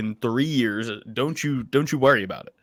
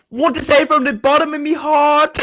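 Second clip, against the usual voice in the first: slow, much higher mock Irish voice; Irish accent; 10 semitones higher, 4 dB louder than usual. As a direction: play the Irish fighter thanking fans. Imitating Irish fighter's speech.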